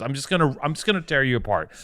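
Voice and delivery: usual speaking voice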